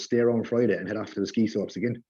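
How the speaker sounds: ordinary delivery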